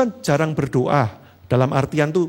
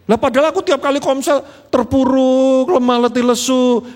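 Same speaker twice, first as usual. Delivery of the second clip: mock self-pity; 9 semitones higher, 6 dB louder than usual. Imagined weak member lamenting.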